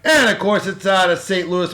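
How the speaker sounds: ordinary talk, nothing marked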